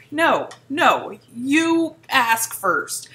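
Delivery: ordinary talk, nothing marked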